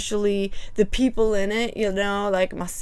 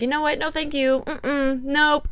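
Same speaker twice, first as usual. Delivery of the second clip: higher, sassy no-thank-you; mock refusal; 6 semitones higher than usual. Reacting to husband's story, higher sassy refusal. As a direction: wave it off with sass. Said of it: "and then I'm like".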